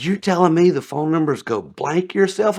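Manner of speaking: mock angry customer. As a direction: throw the suspicion back at him. Angry customer's retort retold.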